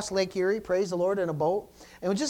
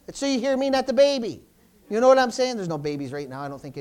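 ordinary talk, nothing marked

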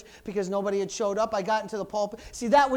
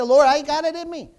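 pleading prayer; 3 semitones higher, 7 dB louder than usual. Pretend. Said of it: "You say".

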